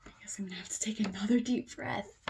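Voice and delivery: fast hushed whisper; quick whispered aside. Real, not acted. Bracing for the next page, a fast whisper. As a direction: rush it out under your breath.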